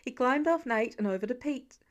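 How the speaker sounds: ordinary delivery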